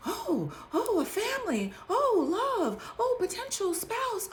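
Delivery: high, breathless, starry-eyed mock gasps; mock swooning. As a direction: play the dazzled naive lover. Mocking a starry-eyed, unhealed self.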